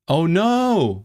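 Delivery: high-pitched drawn-out groan of dismay; playful dismay. Real, not acted. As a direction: wail it in mock despair. Mock dismay at the German pun.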